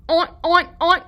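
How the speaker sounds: put-on playful oink oink for the kids; pig oinks. Shown as a demonstration. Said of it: "What sound does a pig make?".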